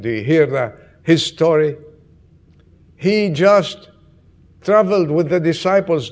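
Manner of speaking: loud, urgent sermon telling; fervent preaching. Acted. Pastor to congregation, loud fervent preaching. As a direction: preach it out to the whole church. Preacher retells Lazarus story loudly.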